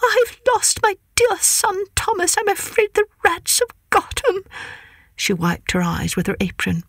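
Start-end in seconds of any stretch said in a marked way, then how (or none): 0.0-4.4 high, fretful mother cat near tears; mother cat greeting her cousin, high and tearful. Acted. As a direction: plead like a worried mother cat. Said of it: "shedding tears".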